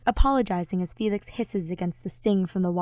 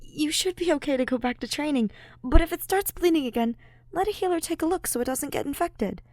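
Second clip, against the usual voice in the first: higher, lighter voice; higher voice, nervous advice; Ash nervous; 9 semitones higher than usual. Acted. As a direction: give advice while embarrassed. Ash giving advice, flustered.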